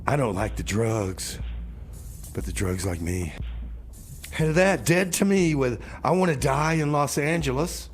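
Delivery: ordinary delivery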